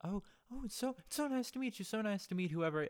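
high, fast and overly sweet; gushing fifties sitcom mom; sitcom family voice. Retold or imitated. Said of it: "They're like".

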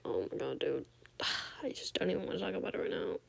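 low groan, then a sigh; weary exasperation. Retold or imitated. Retelling her own reply to the hungover friend, wearily exasperated. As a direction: sigh it out, mildly fed up.

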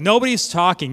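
ordinary talk

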